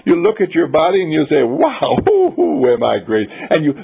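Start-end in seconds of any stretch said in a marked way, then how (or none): 1.4-3.3 laughing whoop at yourself; delighted self-admiration. Pretend. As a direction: gleefully admire your body in the mirror. Admiring your own body.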